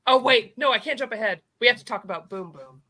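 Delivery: ordinary delivery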